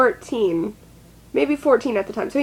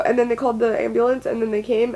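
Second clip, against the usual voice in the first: usual speaking voice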